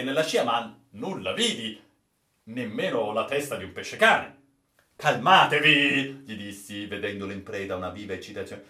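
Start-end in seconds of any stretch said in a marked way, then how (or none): 5.0-6.2 loud, drawn-out command; raised-voice urging; urgent calming order. Acted. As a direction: call it out slowly and firmly. Sailor urges raving officer.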